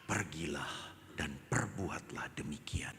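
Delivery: Jesus. Acted. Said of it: "kata Yesus yang terakhir".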